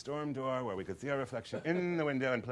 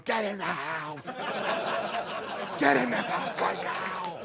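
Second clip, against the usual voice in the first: high, raised voice calling out; mother's mock yell; 9 semitones higher than usual. Mother ordering her son into the house, exasperated yell.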